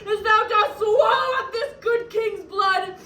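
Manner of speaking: high, sobbing cry; tearful, impassioned wailing; anguished plea for vengeance. Acted. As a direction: cry to heaven, swelling into a shout. Widow calls down vengeance, weeping.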